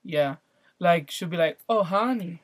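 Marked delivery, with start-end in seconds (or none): 1.7-2.4 lightly imitated Southern "honey"; a mom picking up a Southern twang; put-on Southern endearment; mock Southern accent. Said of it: "starting to talk a little Southern".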